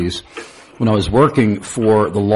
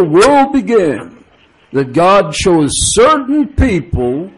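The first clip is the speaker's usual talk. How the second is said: put-on much higher, heavy Brooklyn accent, slowed and puzzled; thick Brooklyn accent; 9 semitones higher, 4 dB louder than usual. Radio caller Ira presses the pastor, in a thick high Brooklyn accent. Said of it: "a very thick Brooklyn accent".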